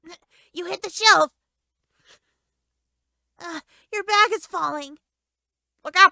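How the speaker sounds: warning the character the bag is falling, frantic; panicked alarm. Real, not acted. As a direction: shout warnings as it tips.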